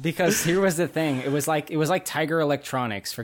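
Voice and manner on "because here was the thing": laughing, a bit louder. Real, not acted. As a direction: build up the rant, laughing. Ranting about Yak Baks.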